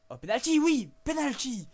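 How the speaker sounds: loud high yelp of joy at the penalty; penalty!, shouted with real delight; excited shout. Real, not acted. Player cheering a penalty for his side, excited shout.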